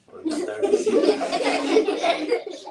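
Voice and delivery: high, slow, laughing. Pretend. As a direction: coo it through a laugh. Playful affectionate name for the student.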